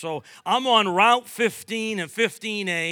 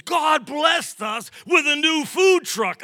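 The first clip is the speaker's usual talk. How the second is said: loud, slow, high-pitched exclaiming of the good news; excited shout; 10 semitones higher, 9 dB louder than usual. Retold or imitated. Staff member bursting to tell the pastor, a loud, slow, excited shout. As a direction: belt it out like thrilling news.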